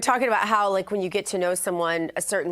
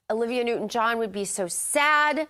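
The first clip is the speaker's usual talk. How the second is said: ordinary talk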